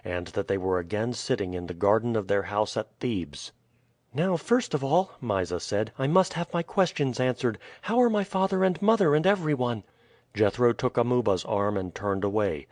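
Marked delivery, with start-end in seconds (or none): 4.1-9.8 put-on high girlish voice, reproachful and puzzled; a worried young girl questioning a priest; lightly voiced girl, anxious; young girl Mysa.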